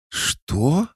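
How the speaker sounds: very high-pitched indignant squeak. A narrator's reading. Cat learns of the harness, indignant. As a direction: shoot up high in outraged disbelief. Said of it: "с возмущением посмотрела на меня".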